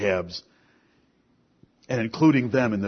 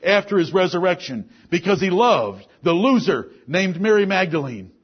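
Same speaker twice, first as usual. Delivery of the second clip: higher, louder preaching rising through the list; preaching at full force; 8 semitones higher, 6 dB louder than usual. Acted. Preacher rousing the congregation with saved sinners, full force. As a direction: preach it out, each name hitting the congregation. Preacher listing apostles, demoniac.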